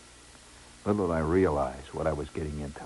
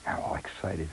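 usual speaking voice

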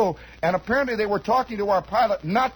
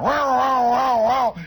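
high, loud revving engine sound; engine noise imitation; 6 semitones higher, 5 dB louder, slower than usual. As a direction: imitate radial engines winding up. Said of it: "you could feel that torque going".

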